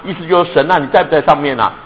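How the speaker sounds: ordinary talk, nothing marked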